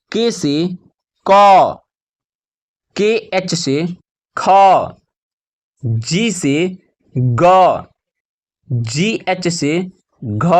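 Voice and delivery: slow chant of spelling, then the letter held long; measured classroom recitation with pauses; spelling drill. Shown as a demonstration. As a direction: land firmly on each drawn-out consonant.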